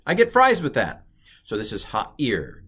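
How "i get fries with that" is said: joking quip. Pretend. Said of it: "It's a combination".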